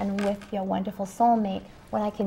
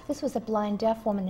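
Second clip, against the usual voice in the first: normal talking voice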